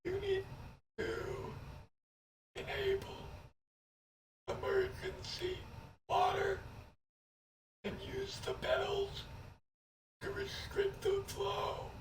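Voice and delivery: high, airy corpse voice drawn in on the breath; talking while inhaling. Acted. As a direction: speak on the in-breath like a dead thing. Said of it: "how to speak while inhaling".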